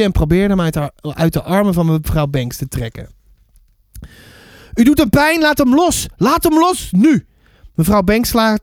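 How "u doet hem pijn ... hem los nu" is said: high-pitched, insistent protest; urgent angry demand. Acted. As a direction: insist harder, ending on a punched word. Child demands dog be released. (boos en dringend, hoge stem)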